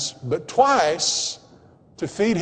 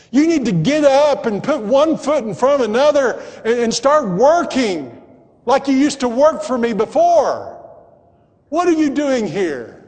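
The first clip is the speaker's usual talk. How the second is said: high, insistent exhortation; impassioned preacher voicing a rebuke; God rousing a believer; 7 semitones higher than usual. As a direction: build from appeal to forceful urging. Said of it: "Maybe God's speaking to you".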